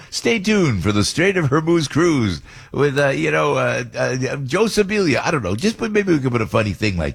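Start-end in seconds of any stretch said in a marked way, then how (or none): 0.1-5.2 slow, raised hammed-up promo announcer; mock promo read. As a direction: sell it like a cheesy radio teaser, slowly. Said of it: "you should put together a little promo".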